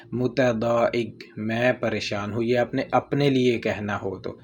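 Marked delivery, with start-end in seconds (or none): 0.1-1.2 slow, careful word; slow demo. Shown as a demonstration. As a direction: say it slowly to memorise. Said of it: "متضاحک لفظ آپ یاد کر لیں". (آہستہ لفظ)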